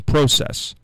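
process said "PRO-sess"; first syllable of process like "pro"; long-o pronunciation. Shown as a demonstration. Host quoting a listener's complaint, process with long o. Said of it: "talks about me saying".

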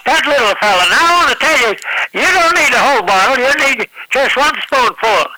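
a hint of a southern accent medicine-show pitch; mild southern accent huckster selling from a wagon; Colonel Parker southern pitchman. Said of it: "in my southern accent".

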